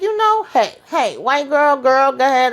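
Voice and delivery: high, lively imitation of egging her on; high sassy cheer; mock hype. Danny hyping in quoted talk.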